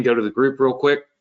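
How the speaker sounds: normal talking voice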